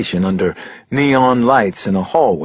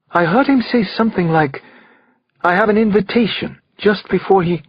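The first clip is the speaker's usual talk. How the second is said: higher voice, breaking off unfinished; trailing off; 8 semitones higher than usual. A narrator's reading. Witness recalling the killer's words, trailing off. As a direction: shaken witness losing her thought. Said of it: "She trailed off, not able to finish".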